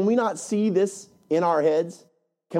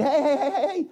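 Aaron pleading; 9 semitones higher than usual. Retold or imitated. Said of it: "spoken up and said".

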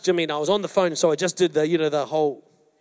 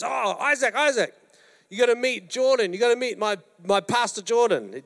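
lightly voiced eager friend, pitched up; Jimmy's greeting; 7 semitones higher than usual. Pastor imitating Jimmy introducing him to a visitor, cheerful and high. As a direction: mimic Jimmy's happy introduction, raised pitch. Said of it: "and Jimmy goes".

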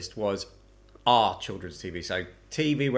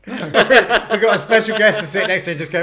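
laughing; 6 semitones higher, 9 dB louder than usual. Pretend. Laughing mock guest.

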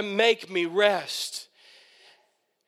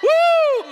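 high, loud whoop; joyful woo; 9 dB louder than usual. Real, not acted. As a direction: let out a gleeful whoop.

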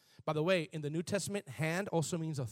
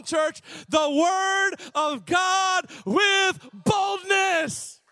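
high, shouted sermon delivery; full-force preaching; 12 semitones higher, 9 dB louder than usual. Real, not acted. Preacher urging church to seek boldness, impassioned shouting.

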